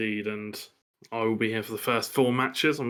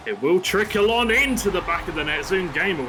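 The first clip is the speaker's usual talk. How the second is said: excited goal call; 5 semitones higher, 5 dB louder than usual. Real, not acted.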